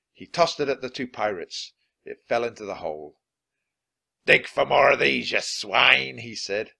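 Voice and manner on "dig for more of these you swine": loud, scornful command; gruff captain's order. A narrator's reading. Captain orders two pirates to dig, loud and scornful. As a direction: order the crew like a pirate boss.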